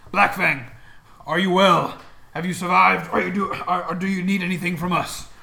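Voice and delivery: loud concerned gnoll. Acted. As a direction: call out to the leader like a hyena-man. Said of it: "their voices were a little bit higher pitched".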